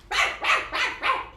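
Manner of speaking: high, quick dog barks. Acted. Oscar waddling to the rescue, high barking. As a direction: bark like an eager dog. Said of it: "with a loud bark".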